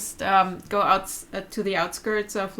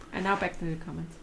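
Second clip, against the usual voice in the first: ordinary talk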